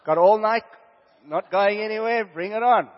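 loud, high, eager bring-it-on cry; eager shout. Retold or imitated. Preacher retelling his eager reply to God, loud and excited.

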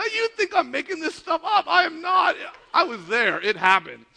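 high, loud, amused insistence; insistent protest. Real, not acted. Preacher swearing to the crowd it happened, insistent protest. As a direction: protest loudly that it really happened. Insisting to audience the story is true.

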